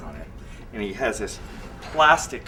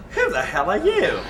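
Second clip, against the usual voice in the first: raised, higher mock challenge, with a laugh; retold challenge; 10 semitones higher, 5 dB louder than usual. The new boss sizing up the stranger, retold as a laughing mock challenge. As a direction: toss the line out half-laughing, higher and louder. Boss confronts him in kitchen.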